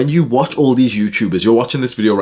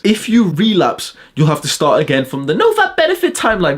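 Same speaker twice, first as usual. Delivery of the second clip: mocking YouTuber; 7 semitones higher than usual. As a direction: stretch it out sneeringly. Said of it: "Think about how disgusting that advice is".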